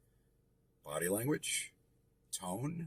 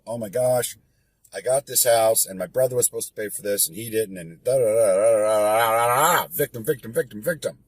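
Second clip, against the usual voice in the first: mock whiny victim; 2 semitones higher, 8 dB louder than usual. Mocking a whining seller.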